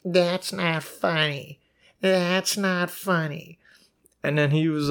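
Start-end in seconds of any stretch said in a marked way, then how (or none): 0.0-3.6 slow, prissy scolding; uptight lady. Retold or imitated. Narrator mocking an offended lady passenger, slow and prissy. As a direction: drag it out, disapproving. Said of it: "this one lady goes".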